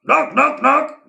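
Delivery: high, loud hammering knocks; loud knocking. Acted. Postman pounding the door, loud. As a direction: bang out each knock.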